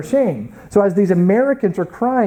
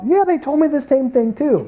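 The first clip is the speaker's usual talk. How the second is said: high-pitched, aggrieved chiming in; indignant agreement; 8 semitones higher than usual. Retold or imitated. Staffer agreeing with offended director, indignant agreement. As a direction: chime in with exaggerated grievance.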